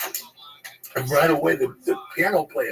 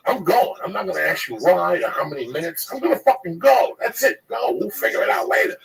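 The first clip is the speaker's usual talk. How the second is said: fed-up retold outburst, higher pitched; getting mad; 4 semitones higher than usual. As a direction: act out an insistent, angry decision. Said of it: "from getting mad at Jeff and Paulie Shore".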